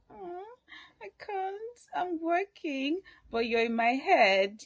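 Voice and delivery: a hint of a girl on the phone to her lover; imitating Chioma. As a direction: play the lovesick girlfriend, lightly. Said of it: "I'm like".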